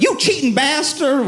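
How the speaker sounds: high-pitched mock indignant cry; mock angry outburst. Fed-up woman stands up in a restaurant, hammed-up outburst. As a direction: burst out like a fed-up partner, hammed up.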